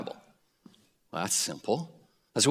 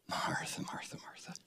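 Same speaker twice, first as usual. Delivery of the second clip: much softer, hushed self-reproach; soft self-chiding; 19 dB quieter than usual. Shown as a demonstration. Preacher shows how he quietly chides himself by name. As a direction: gently scold yourself under your breath. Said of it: "I'm talking to myself".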